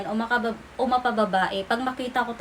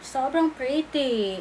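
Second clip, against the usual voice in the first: dreamy self-praise; much slower than usual. Shown as a demonstration. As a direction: draw out "Sobrang pretty" slowly, admiring. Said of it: "Imagine, te". (mabagal at mahinang "Sobrang pretty")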